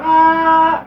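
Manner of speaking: short high grunting yell; mock straining yell. Mimicking Trinity straining in the trailer, high yell. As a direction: heave it out like lifting hard.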